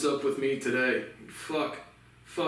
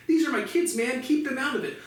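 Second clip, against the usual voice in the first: firm protective quote, pitched up; Mark Cuban; 9 semitones higher than usual. Retold or imitated. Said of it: "like Mark Cuban said".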